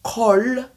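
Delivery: "Call" with rounded vowel and rising tune; "Call" said the French way; wrong call. Shown as a demonstration. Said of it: "ce n'est pas la meilleure".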